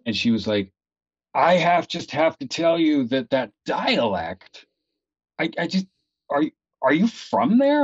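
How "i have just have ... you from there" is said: higher, halting voice of wonder; imitated amazed admirer. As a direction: act out an astonished theatergoer, pitched up. Acting out an amazed audience member.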